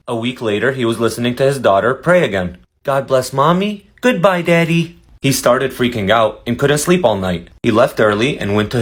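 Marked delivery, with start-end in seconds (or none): 2.8-5.0 put-on praying child. Child praying, voiced higher.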